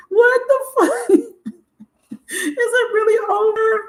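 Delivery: high, shaky laugh-cry; real laughing cry, voice up high; laughing through tears. Real, not acted. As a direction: laugh at yourself while half crying. Laughing while crying over her twin flame.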